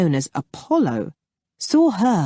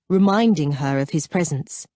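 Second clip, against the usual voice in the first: ordinary delivery, nothing marked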